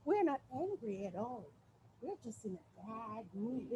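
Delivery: soft, calm restatement; changed tone. Acted. Performer restating softly after changing her tone. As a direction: say it softly and sweetly. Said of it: "Let me change my tone".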